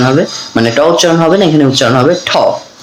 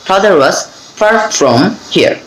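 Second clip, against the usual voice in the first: "Father was", pause, then a slow "far from here"; slow split drill; much slower than usual. Shown as a demonstration. Teacher splits the drill into slow chunks. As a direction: chunk the sentence slowly. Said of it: "এভাবে কিন্তু".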